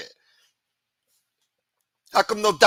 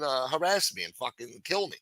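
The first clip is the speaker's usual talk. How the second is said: ordinary talk, nothing marked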